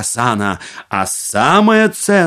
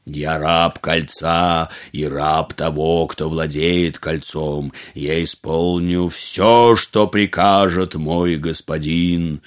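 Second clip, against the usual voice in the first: booming genie voice; 7 semitones lower, slower than usual. A narrator's reading. The genie keeps speaking.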